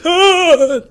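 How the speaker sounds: sudden high yelp of pain; old man yelping as he's shoved down the stairs; old man's cry. Acted. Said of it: "And the old man goes".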